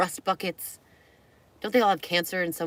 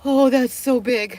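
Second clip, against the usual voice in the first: creeped out; 5 semitones higher, 10 dB louder than usual. Real, not acted. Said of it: "I'm so creeped out right now".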